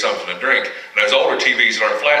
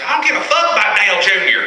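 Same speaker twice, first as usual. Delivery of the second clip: put-on drunk guy voice; 12 semitones higher, 4 dB louder than usual. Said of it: "He goes".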